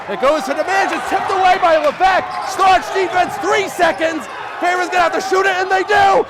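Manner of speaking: building play-by-play yell; excited shout. Real, not acted. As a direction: scream the buzzer-beater. Buzzer-beater call, live.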